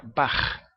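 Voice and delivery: "Bach" ending in a rasped kh; guttural final ch. Shown as a demonstration. Teacher giving an example of the ch sound, guttural ending. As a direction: scrape the throat on the end of "Bach". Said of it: "la pronuncian igual".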